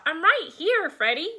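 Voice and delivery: high, loud answering call; calling back. A narrator's reading. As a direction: call up from under the pile. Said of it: "Darla called back".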